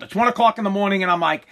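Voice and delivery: ordinary talk, nothing marked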